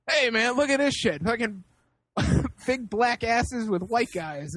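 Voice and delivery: mild mock yell across a store aisle; retold yelling out. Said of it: "He's yelling them out".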